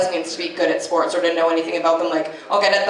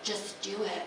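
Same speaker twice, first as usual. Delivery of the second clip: mock sultry murmur; 13 dB quieter than usual. Hookup in bed, asking softly and seductively. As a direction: purr it like a bedroom request. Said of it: "She's like".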